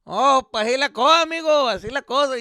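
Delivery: loud, high paisa holler; paisa act. Acted. A paisa starts talking to you, loud and chummy. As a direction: boom it out like an over-friendly paisa. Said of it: "when a paisa starts talking to".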